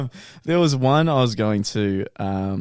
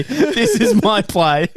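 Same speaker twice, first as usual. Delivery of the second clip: laughing; 9 semitones higher, 4 dB louder, much slower than usual. Pretend. Hammed-up repeat, laughing.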